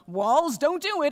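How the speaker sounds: loud, high plea; urgent command. Acted. Rabbi ordering the walls not to fall, urgent shout. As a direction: call out sharply to stop them.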